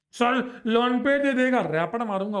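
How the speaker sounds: high, loud student blurting out; pushed-up, shouted classroom interjection; mock student outburst. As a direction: imitate a pupil calling out an objection. Voicing a student's eager objection.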